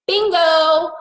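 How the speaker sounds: high, loud triumphant bingo; bingo exclaim. Retold or imitated. Hearing of a rival's earnings, loud high triumphant bingo. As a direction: pounce on the chance. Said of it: "And I was like".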